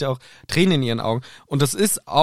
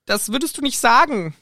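voicing Hermine; 9 semitones higher than usual. Voicing Hermine reading aloud.

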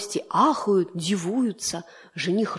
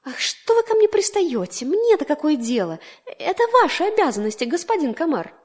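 much higher, louder, irritated squeal; peevish fly; 7 semitones higher, 5 dB louder than usual. Acted. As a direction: brush him off, haughty and annoyed. The fly snapping back at the mosquito. (капризно и раздражённо)

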